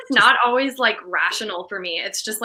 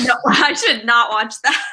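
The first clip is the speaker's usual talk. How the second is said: laughing refusal; 6 dB louder than usual. Real, not acted. Refusing gross movie laughing.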